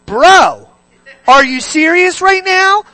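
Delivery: loud, high mock disbelief; incredulous friend. Holy Spirit catching you in the act, loud incredulous rebuke. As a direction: call out your buddy, voice shooting up. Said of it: "literally sitting there doing".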